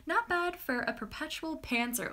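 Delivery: normal talking voice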